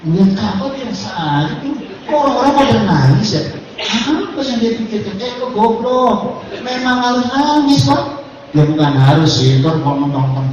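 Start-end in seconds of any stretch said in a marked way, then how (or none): 0.1-8.0 lightly voiced, higher puzzled friend with a laugh; a hint of the friend who can't cry, baffled. Preacher imitating a pilgrim friend who never cries, lightly baffled. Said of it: "ada teman saya nggak bisa nangis".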